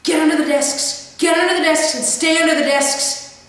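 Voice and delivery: loud, high panicked-teacher yell; shouted urgent command. Retold or imitated. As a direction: yell the order urgently at the whole class. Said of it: "he just kept yelling at us".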